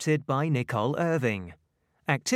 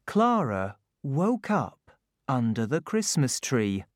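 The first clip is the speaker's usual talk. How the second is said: ordinary delivery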